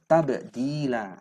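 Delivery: "Tabdila" slow, the b bounced with a small echo; qalqalah bounce. Shown as a demonstration. Teacher shows students the qalqalah on "Tabdila". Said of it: "Ketemu qalqalah lagi ya".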